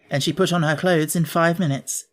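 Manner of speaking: plain reading voice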